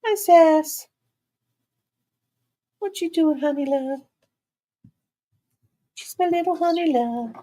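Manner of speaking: high sweet pet talk. Real, not acted. Baby talk to the dog.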